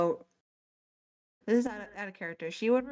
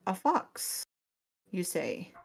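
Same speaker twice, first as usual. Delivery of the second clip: intrigued fox question; slower than usual. Acted. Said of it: "she goes".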